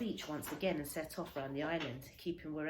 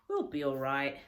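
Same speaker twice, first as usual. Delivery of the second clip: firm, louder reassurance to the dog; steady her with a confident promise; a boy talks himself and his dog out of panic, loud and sure; reassuring Stella; 3 semitones lower, 8 dB louder than usual. A narrator's reading. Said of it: "I told Stella".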